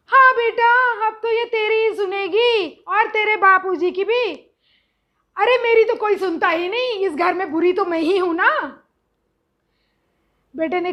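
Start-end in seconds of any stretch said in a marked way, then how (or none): 0.1-8.8 dramatic self-pity. Retold or imitated. Mother complains to son, loud drama-queen self-pity. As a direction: ham up the martyred mother. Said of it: "अगर मैं drama queen".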